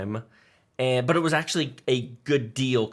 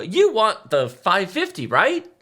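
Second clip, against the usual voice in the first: mock salesman pitch; 8 semitones higher, 5 dB louder, slower than usual. Salesman pitching part to customer.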